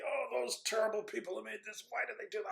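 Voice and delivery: a hammed-up grumble about the makers; mock angry complaint. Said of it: "you could get really angry and say".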